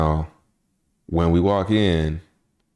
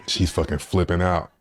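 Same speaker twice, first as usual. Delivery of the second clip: ordinary delivery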